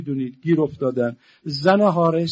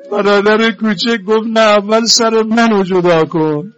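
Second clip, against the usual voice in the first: louder, higher lamenting preacher voice; full-force mourning sermon; 6 semitones higher, 10 dB louder than usual. Acted. Preacher telling mourners of the martyred children, loudly. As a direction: drive the tragic story home to the crowd.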